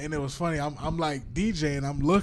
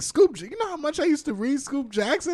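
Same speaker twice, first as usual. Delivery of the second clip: much higher, starstruck gush; starstruck excitement; 14 semitones higher, 5 dB louder than usual. Retold or imitated. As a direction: gush like a fan meeting his hero. Excited about meeting his idol.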